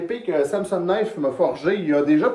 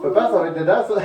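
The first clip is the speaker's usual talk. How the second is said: ordinary talk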